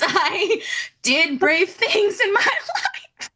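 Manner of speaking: high, drawn-out, breaking into laughter; laughing through words. Real, not acted. Telling of mother's gaslighting, laughing helplessly.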